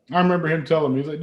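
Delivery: ordinary talk, nothing marked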